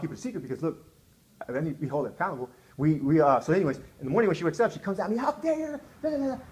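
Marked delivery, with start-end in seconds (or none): 5.2-6.4 high-pitched indignant scolding; mimicked cussing out. Wife confronting husband next morning, imitated in high indignant scolding. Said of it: "she's just cussing me out".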